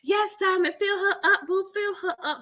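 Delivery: egging her on, playful and high; hyped cheerleader voice. Retold or imitated. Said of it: "I was speaking for her".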